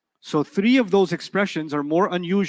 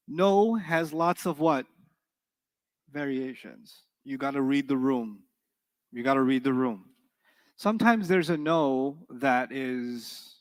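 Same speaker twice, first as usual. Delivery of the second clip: usual speaking voice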